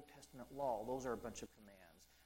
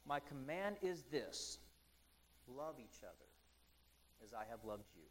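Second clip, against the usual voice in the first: softer scripture reading; 2 semitones lower, 3 dB quieter than usual. Acted. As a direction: reread the command gently, letting it sink in. Repeats the verse, softer.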